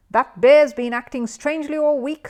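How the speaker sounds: high, loud and insistent; emphatic alarm. Acted. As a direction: press the worry hard, voice up. Missus Bird worried.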